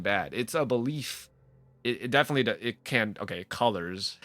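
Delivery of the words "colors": ordinary talk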